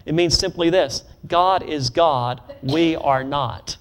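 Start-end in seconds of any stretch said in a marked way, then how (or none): 1.3-3.8 God is God, slow, with God stressed; slow emphatic creed. Shown as a demonstration. Preacher giving the line for the church to repeat, slow and weighty. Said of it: "You wanna say that with me?".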